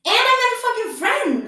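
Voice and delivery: high, loud thrilled exclamation; real excitement. Real, not acted. Vlogger sharing that she made a friend, excited outburst. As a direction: burst out with delight.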